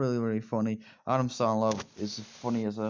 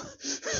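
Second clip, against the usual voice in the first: real burst of laughter; 7 dB quieter than usual. Real, not acted. Laughs at own impression.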